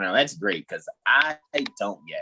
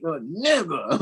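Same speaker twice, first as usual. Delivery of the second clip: comedian imitation; 6 semitones higher than usual. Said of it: "when a comedian was like".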